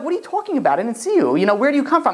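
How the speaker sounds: high-pitched mock bewilderment; acted bafflement. Friend answering a sudden rejection, high baffled protest.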